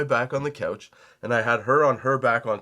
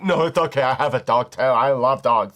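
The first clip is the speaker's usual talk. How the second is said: lightly voiced breezy young woman, a bit louder; mock woman's reply; 5 dB louder than usual. Young woman dismissing the owner's warning, lightly mocked. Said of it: "woman replying to owner".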